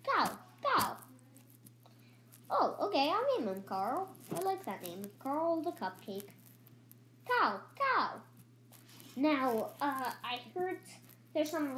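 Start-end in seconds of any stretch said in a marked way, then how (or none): 0.0-1.0 high squeaky creature call of cow, cow; a small critter's high call; pet cupcake's cry. Acted. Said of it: "he's always gonna say cow, cow". 7.3-8.2 high squeaky creature call of cow, cow; a small critter's high call; pet cupcake's cry. Acted. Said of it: "he's always gonna say cow, cow".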